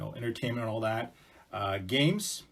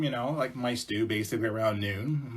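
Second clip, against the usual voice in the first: ordinary delivery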